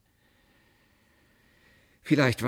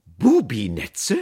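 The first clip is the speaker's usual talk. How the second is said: loud, drawn-out, raised question; stern; 6 semitones higher, 11 dB louder, much slower than usual. A narrator's reading. Aunt challenging the apprentice's word, stern and loud. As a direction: repeat it slowly, in stern disbelief. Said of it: "fragte Tante Fanny streng".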